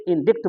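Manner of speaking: इंडिक्ट with short i and sounded k; mistaken pronunciation. Retold or imitated. Teacher mimics the wrong reading, इंडिक्ट with audible k. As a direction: read इंडिक्ट as spelt, "dikt" at the end. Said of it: "जो गलत है".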